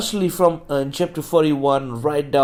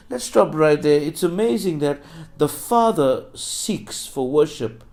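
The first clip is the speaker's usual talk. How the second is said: ordinary delivery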